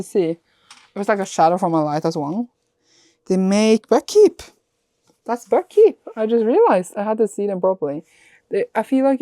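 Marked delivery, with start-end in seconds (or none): 3.3-6.0 excited. Real, not acted. Discovers the edge art.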